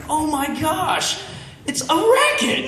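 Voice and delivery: high, incredulous exclamation; acted outrage. Storyteller discovers the beggars' scam, scandalised amazement.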